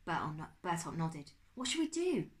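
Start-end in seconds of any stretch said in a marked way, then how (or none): 1.5-2.3 put-on high, anxious young boy; worried boy voice. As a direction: ask it as a frightened kid. Bertolt frightened.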